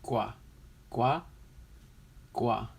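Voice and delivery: "Gua" alone, first falling, then questioning, then falling; testing "Gua" with pauses between tries; practising the ending. Shown as a demonstration. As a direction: try out "Gua" as an isolated syllable. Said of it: "that's how you say the end".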